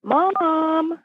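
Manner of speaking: lightly voiced daughter calling for Mom. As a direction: call out like a young girl from another room. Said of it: "my daughter's voice going".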